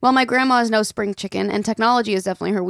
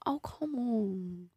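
whisper; 15 dB quieter, much slower than usual. Real, not acted. Coaxing friend to speak.

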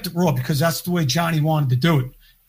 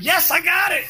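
insistent retold shout; 11 semitones higher than usual. Insisting to Joe in the studio.

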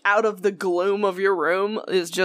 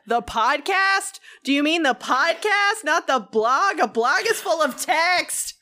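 high, incredulous mock outrage; passionate indignation; 7 semitones higher, slower than usual. Correcting coworkers who called a podcast a blog, indignant. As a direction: stretch the words in rising indignation. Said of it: "I'm really passionate about the difference".

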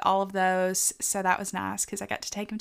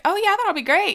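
much higher and louder, sarcastically upbeat; mock cheery; 12 semitones higher, 6 dB louder than usual. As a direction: play the fake optimist who sugarcoats everything. Mock of upbeat sugarcoating reply.

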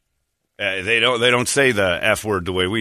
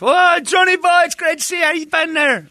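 Ray, effusive greeting. Acted. Old trainer greets Johnny after years, high gushing holler. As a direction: holler a gushing welcome at the top of the range. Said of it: "if Ray came back into my life".

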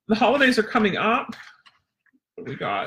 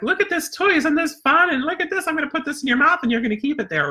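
high, bubbly sell to the dog; excited dog voice; 9 semitones higher than usual. Retold or imitated. Trainer hyping a toy to her dog, excited and high. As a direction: pitch it way up like a new toy is magic. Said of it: "I'll be like".

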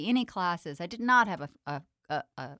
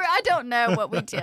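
laughing; 5 semitones higher, 8 dB louder than usual. Real, not acted. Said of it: "Oh, God".

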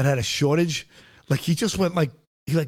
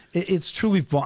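ordinary talk, nothing marked

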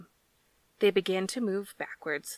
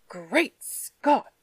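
loud, higher gasping exclamation; gurgled exclamation; 5 semitones higher, 12 dB louder than usual. A narrator's reading. Stunned man on the sand, a loud gurgling exclamation. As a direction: blurt it out half-choked. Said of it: "he gurgled".

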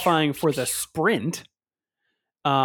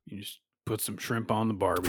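put-on Aussie accent; 6 semitones lower, 8 dB quieter than usual. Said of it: "in the same accent".

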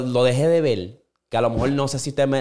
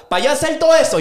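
much higher and louder burst; excited; 13 semitones higher, 6 dB louder than usual. Real, not acted. Telling friends about the episode, a high loud excited burst.

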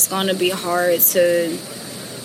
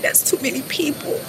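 tearful whisper; 6 semitones higher, 5 dB quieter, faster than usual. Real, not acted. Breaking down in tears.

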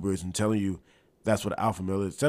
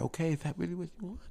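high, fast lispy boxer voice; Mike Tyson impression; 8 semitones higher, 6 dB quieter, faster than usual. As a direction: do Tyson's soft, lispy squeak.

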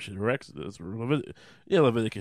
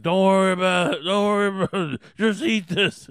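loud, higher, strained mock yell; hammed-up shout through pain, waving off concern; Jesus brushing off wounds; 7 semitones higher, 7 dB louder than usual. Wounded Jesus to worried disciples, strained mock-pained yelling. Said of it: "And he's like".

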